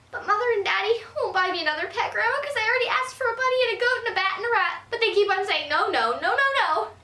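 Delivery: put-on high, fast whiny kid voice; pleading little girl. Girl complaining to grandma about her parents, high and whiny. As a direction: rush the complaint like a wronged child.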